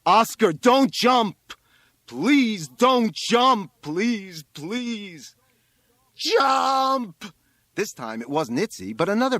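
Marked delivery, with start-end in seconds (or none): 0.0-5.3 slow, high, loud begging; desperate pleading. A narrator's reading. Rabbi begs boy not to jump. 6.1-7.3 long, high, very loud shout; young voice shouting. A narrator's reading. Another classmate shouts from crowd.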